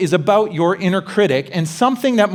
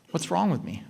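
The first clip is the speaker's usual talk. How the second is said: soft, intimate self-question; a softly spoken inner critic; hushed inner voice; 9 dB quieter than usual. Shown as a demonstration. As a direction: ask it quietly to yourself. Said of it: "Five words".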